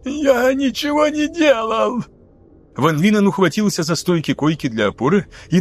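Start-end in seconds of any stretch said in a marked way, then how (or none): 0.0-2.0 much higher, slow, squeezed; strangled voice. A narrator's reading. Lieutenant with a boot on his throat, voice strangled. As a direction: speak with a crushed throat. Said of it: "Голос был искажён давлением".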